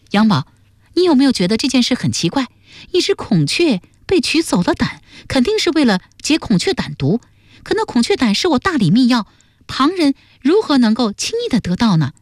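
high-pitched, questioning female character; earnest young woman. Acted. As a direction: press him with a puzzled question. Voices Duan Sengnu in the story.

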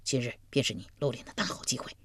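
low, soft conspiratorial voice; hushed. A narrator's reading. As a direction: lean in and say it softly. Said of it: "小声说".

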